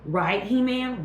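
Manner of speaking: ordinary talk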